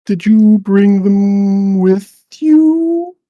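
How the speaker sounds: loud, slow, high drawn-out creature call; stretched, loud river-creature voice; hard-to-understand river lord. Acted. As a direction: boom each word out long and slow. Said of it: "very difficult to understand what they're saying".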